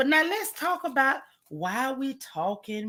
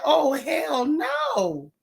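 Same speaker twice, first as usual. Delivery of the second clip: shocked disbelief; 5 semitones higher, much slower than usual. Retold or imitated. Shocked reaction to reel.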